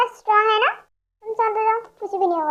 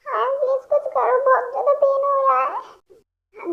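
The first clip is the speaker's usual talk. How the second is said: whining in pain; 5 semitones higher, slower than usual. Acted. Sick girl begs for help.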